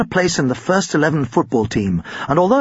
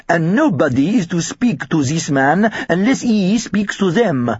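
put-on heavy French accent, stern warning; strict French teacher's accent; exaggerated French accent; 3 semitones higher than usual. A narrator's reading. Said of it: "in his exaggerated French accent".

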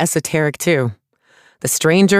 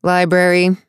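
bored; 3 semitones higher than usual. A narrator's reading. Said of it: "the artificer said in a bored voice".

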